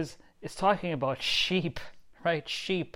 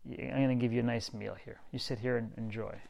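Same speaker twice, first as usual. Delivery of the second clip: softly voiced host offering a meal; quiet, understated divine invitation; God speaking gently; 3 semitones lower, 7 dB quieter than usual. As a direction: lower the volume, an easy reassuring invitation. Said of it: "and God says that".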